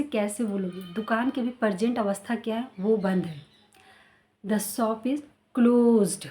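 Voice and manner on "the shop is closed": very slow, "closed" with clear ed; slow model sentence. Shown as a demonstration. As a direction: teach it slowly. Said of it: "close नहीं, closed".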